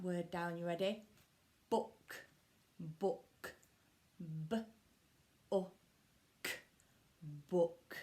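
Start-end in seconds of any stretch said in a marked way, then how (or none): 1.7-8.0 로봇처럼 끊어서 말하기; stretched-out sounding. Shown as a demonstration. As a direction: chop the word into robot sounds. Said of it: "chop it up with our robot arms".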